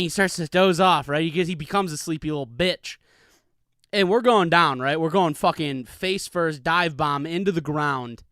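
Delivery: ordinary talk, nothing marked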